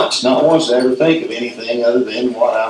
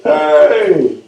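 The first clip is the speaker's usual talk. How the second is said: mock holler; 4 semitones higher, 4 dB louder than usual. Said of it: "hollered at it".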